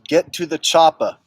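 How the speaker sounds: a first, lighter take on the chopper line in Arnold's accent; Arnold impression. Shown as a demonstration. Viewer requests chopper line, light Austrian accent. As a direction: say it like Arnold, offhand. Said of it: "[name] says".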